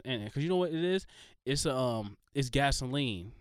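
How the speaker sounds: ordinary talk, nothing marked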